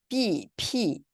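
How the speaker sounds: 삐, 피 with a plain English "ee" vowel; English letter reading. Shown as a demonstration. Teacher shows how English reads the letters, English-style 삐, 피. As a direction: read 삐, 피 like English B and P. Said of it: "영어에는".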